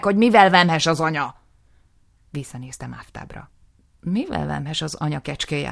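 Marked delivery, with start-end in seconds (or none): 0.0-1.3 loud, high, pressing; angry demand. Acted. Nisim urging the justice, loud and high. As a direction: push the justice to ask.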